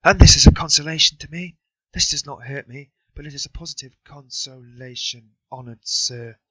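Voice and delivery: calling out. A narrator's reading. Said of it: "he called out".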